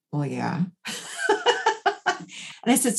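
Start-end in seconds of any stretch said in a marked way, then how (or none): 0.1-0.9 sheepish laugh. Retold or imitated. Client admits boss loves her.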